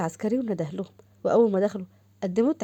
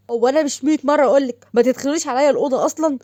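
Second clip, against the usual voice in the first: loud, raised-pitch telling-off; exasperated scolding; 4 semitones higher, 6 dB louder than usual. Acted. Brother telling off his prankster sister, loud and exasperated. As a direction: scold her with indignant force.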